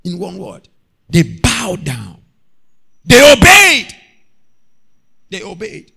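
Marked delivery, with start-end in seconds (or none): 3.0-4.0 high, loud burst on obeyed; preaching shout. Real, not acted. Preacher stressing obedience to the church, shouting. 5.3-5.9 softer repeat; quiet echo. Real, not acted. Preacher echoing to the church, quiet.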